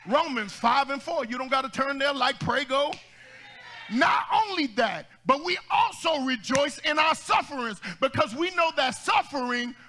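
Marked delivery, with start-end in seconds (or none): 3.9-9.7 preaching at full force. Real, not acted. Scripture shouted slowly.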